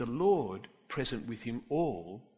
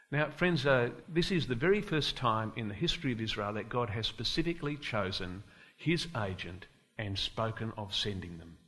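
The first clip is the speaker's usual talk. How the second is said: usual speaking voice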